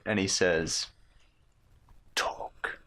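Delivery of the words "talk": one hushed menacing word; whispered command. Acted. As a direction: whisper it with the blade at his throat. Dagger at throat, demanding answer.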